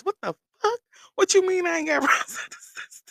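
high, loud, laughing what-do-you-mean; outraged disbelief. Retold or imitated. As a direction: squeal it through a laugh of disbelief. Told she has no roadside help.

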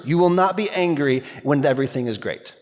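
usual speaking voice

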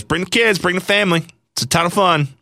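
high-pitched imitation of an interviewee's pitch; Ben's catchphrase. Host quoting Ben's first interview line, high put-on voice. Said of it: "at the end he goes".

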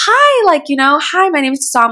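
high, bright-pitched eager greeting, easing down; super friendly. Retold or imitated. Tourist introducing herself to the tour guide, super friendly. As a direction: imitate yourself being over-friendly on first meeting. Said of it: "I'm trying to be super friendly".

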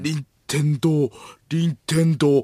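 slow pondering, then outburst of feigned bafflement; 伊集院光. Acted. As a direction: mull the word slowly, then exclaim helplessly. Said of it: "伊集院光さんが". (大げさに悩む伊集院のものまね)

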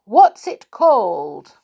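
slow, loud question; speaking loudly. A narrator's reading. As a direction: spell it out loudly for deaf ears. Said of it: "said Beth loudly".

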